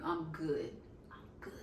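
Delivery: said once, then whispered to himself; reassuring himself, voice dropping to a whisper; fading to a whisper. Retold or imitated. Talking himself up before the interview, fading to a whisper. Self-talk, second one whispered.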